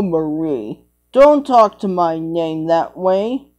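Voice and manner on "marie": normal talking voice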